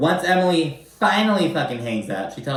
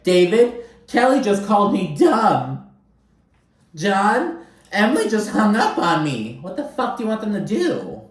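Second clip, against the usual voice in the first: high, louder, whining mock Shannon; whiny Shannon; 9 semitones higher, 3 dB louder than usual. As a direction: wail like a hysterical housewife, hammed up. Shannon crying at the costume party.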